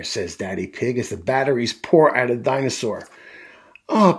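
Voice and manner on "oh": put-on high, loud child's oh; raised voice of a surprised kid; Peppa's surprise. A narrator's reading. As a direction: exclaim like an astonished little girl. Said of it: "Peppa says".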